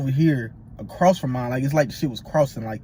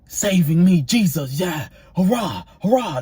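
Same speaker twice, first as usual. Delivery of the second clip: raised, raucous mock gospel hollering; imitated gospel noise; 3 semitones higher, 4 dB louder than usual. Roommate pacing with gospel in his headphones, mock hollering. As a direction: holler along tunelessly, imitating a headphone listener. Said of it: "just making noise".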